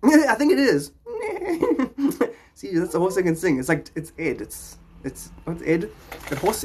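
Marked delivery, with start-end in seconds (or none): 1.0-2.3 a squealing real laugh; high burst of laughter. Real, not acted.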